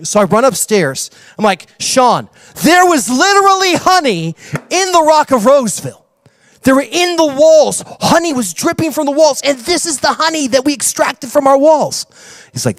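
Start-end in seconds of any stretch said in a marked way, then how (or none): 1.8-12.1 prove your point in a rush of excitement; gushing, higher voice to the evangelist; excited. Retold or imitated. Excitedly showing Sean the honey.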